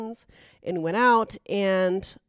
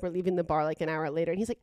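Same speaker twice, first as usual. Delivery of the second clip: normal talking voice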